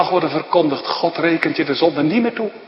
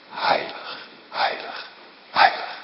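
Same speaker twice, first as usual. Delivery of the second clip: whispered; 7 dB quieter than usual. Acted. Hushed repetition in sermon.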